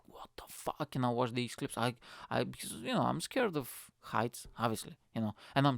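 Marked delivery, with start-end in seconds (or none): 0.1-0.9 whispered. Pretend. Quiet unvoiced reaction.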